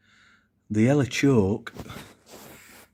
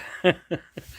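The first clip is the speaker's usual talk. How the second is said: wild rambling cackles; 5 semitones higher than usual. Real, not acted. Farmer laughs after the joke.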